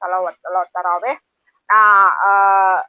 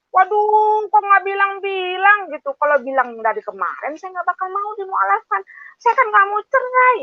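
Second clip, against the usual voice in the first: alarmed protest; 9 semitones higher than usual. Retold or imitated. Retold alarm at divorce news.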